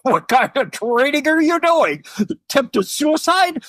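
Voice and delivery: high, laughing disbelief, the last words slowed; mock a Marine who can't believe it; a guard scoffing at a free climber; incredulous Marine. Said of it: "He goes".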